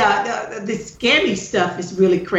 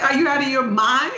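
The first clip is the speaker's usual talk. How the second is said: mock outrage; 7 semitones higher than usual. Retelling reply to scam caller.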